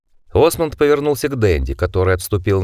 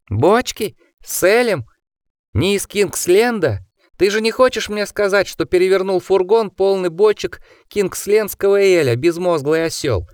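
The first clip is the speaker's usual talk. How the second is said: furious; 9 semitones higher than usual. Acted. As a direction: berate a fool in high fury. Osmond furious at the driver.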